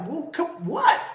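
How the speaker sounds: high, loud stammered what; blown away. Retold or imitated. Husband stunned by his wife's answer, sputtering. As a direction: sputter in shock. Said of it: "I was blown away".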